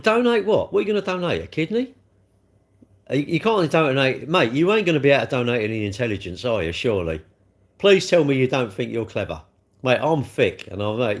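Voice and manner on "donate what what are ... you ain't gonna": higher, louder indignant scoff; mocking a viewer's donation request; scoffing disbelief. Real, not acted. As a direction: scoff at the very idea.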